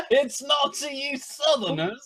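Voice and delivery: very high squeaky Yorkshire echo; mock Yorkshire accent. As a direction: squeak it back as a cartoon northerner. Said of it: "different Yorkshire accents".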